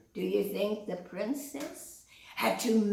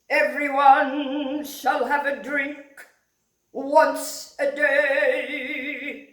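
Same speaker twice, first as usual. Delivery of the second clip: louder, higher, slow singsong royal proclamation; regal lion king; 5 semitones higher, 9 dB louder, slower than usual. Acted. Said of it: "King Lion says".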